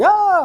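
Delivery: sudden high mock yelp of alarm; panicked yelp. Parent panicking as a little kid falls, mock high yelp. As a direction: imitate a jumpy parent's shriek. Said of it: "I'm not one to run and go like".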